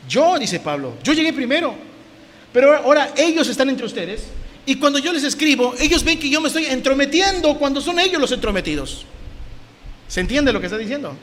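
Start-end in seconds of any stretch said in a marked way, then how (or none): 0.1-0.4 high, loud one-word answer; punched, raised retort; indignant Paul. Acted. Voices Paul answering his own question. 1.0-9.1 high, insistent protest; raised, aggrieved apostle's complaint; indignant Paul. Acted. Voices Paul protesting against intruders.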